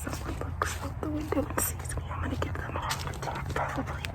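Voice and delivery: soft sneaking whisper. Real, not acted. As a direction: whisper as you creep. Sneaking up again.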